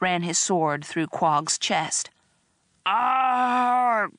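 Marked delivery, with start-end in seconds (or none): 2.8-4.1 long, high creature cry of pain; dying groan. A narrator's reading. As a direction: groan out as the blade goes in. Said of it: "Quog groaned".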